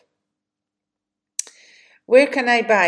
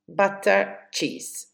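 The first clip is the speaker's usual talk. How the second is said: normal talking voice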